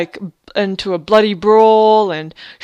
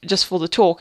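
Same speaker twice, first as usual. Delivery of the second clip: normal talking voice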